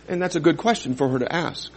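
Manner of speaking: ordinary delivery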